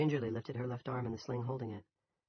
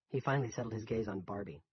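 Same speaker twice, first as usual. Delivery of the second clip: usual speaking voice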